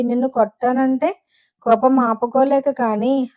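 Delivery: ordinary delivery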